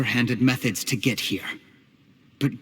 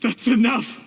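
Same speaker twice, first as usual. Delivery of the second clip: sharp outburst; 9 semitones higher than usual. Acted. Cornered villain cuts her off.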